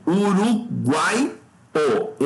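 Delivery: Uruguai, o stretched slowly, guai rising; syllable by syllable. Shown as a demonstration. Teacher splits uruguaio for students, slowly by syllable. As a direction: draw out Uruguai, then set o apart. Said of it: "como é que separa".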